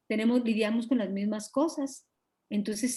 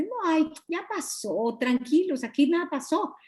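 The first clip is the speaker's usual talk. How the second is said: feigned nonchalance; 6 semitones higher than usual. Acted. Acting out hiding anger behind excuses, high false calm. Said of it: "me estoy escondiendo detrás de".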